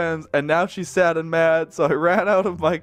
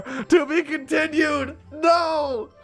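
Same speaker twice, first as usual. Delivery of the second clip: dramatic cliffhanger dismay; 10 semitones higher, slower than usual. Real, not acted. Fanfic chapter ends abruptly.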